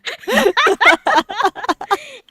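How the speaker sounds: high cackle turning mad; psychopathic laugh. Acted. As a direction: giggle into a crazy cackle. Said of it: "girly giggle to a psychopathic laugh".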